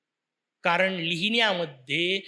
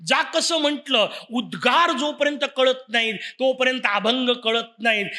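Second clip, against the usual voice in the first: normal talking voice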